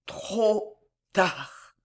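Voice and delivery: drawn-out, failing words with a gap; fading regret. A narrator's reading. Dwarf answers her before fainting, slow and failing. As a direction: draw each word out as if fainting. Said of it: "fit encore une fois le nain".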